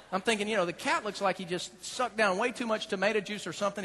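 ordinary talk

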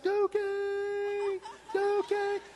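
high call across the yard; searching the yard for the cat. Retold or imitated. Calling for lost cat.

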